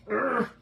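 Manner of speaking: short growly "urgh" of effort; straining grunt. Real, not acted. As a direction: grunt as if heaving something heavy. Straining at something too heavy.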